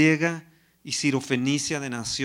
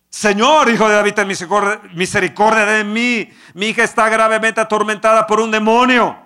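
pleading cry; 5 semitones higher, 11 dB louder than usual. Acted. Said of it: "ella gritaba y le decía".

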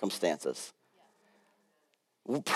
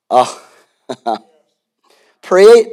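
ordinary talk

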